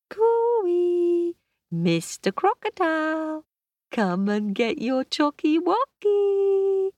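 high, loud sing-song call; calling the croc across the playground. Acted.

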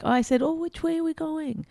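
soft, high, sweetly innocent imitation; mock innocent. Woman mimics partner's harmless version, soft and innocent. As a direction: say it lightly, as if perfectly harmless. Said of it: "when you repeat it, you're like".

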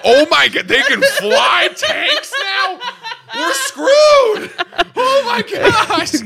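very high, laughing panic shout; mock freak-out. Soldiers seeing flying tanks in the war, mock panic. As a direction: squeal in hammed-up horror, stretching the words. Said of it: "so people are freaking out".

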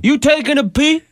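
high, mock De Niro tough guy; hammy De Niro taunt; De Niro on the toilet. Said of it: "we'll try it again".

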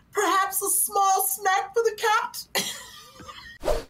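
high, drawn-out mock offer; mimicked catchphrase. Co-host takes a turn at the catchphrase, slow high imitation.

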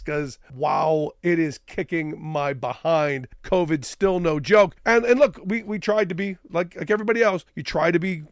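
ordinary talk

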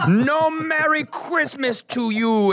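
hammed-up angry trucker shout. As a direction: bark it like a drunk trucker. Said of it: "exclaimed the angry trucker".

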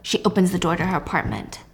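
usual speaking voice

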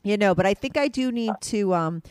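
normal talking voice

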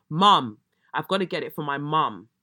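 MUM hit loud and held for the reveal; punchline reveal. Retold or imitated. Telling viewers the driver's punchline, landing mum loud. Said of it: "wait for it".

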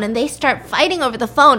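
normal talking voice

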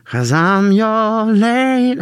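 slow, high, sung lyric in a mock French Canadian accent. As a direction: croon the line like a hammed-up French Canadian diva. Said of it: "Does she sound like this on the other songs?".

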